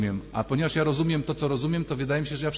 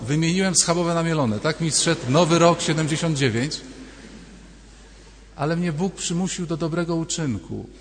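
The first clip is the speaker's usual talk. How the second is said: ordinary talk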